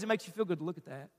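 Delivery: low, soft, quickened insinuation; tempting inner voice. Acted. Preacher voices temptation inside a struggling listener, low and quiet. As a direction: coax quietly, like a voice inside the head. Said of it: "there's this voice inside of you says".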